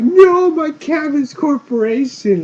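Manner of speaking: loud, high, stretched-out comic wail; hammed-up cry of a ruined merchant; mock anguished cry. Old cabbage merchant losing his corporation, mock anguished cry.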